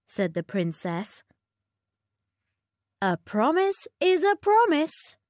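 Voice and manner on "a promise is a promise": high, firm queenly reminder; a hint of a strict mother; stern queen. A narrator's reading. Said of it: "said the Queen".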